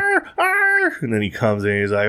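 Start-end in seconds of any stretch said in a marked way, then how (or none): 0.0-0.9 very high yelping whine like a hurt dog; imitated dog cry. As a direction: yelp it out high, like a dog in pain. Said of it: "a dog's cry".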